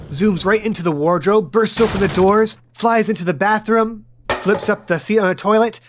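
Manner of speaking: ordinary talk, nothing marked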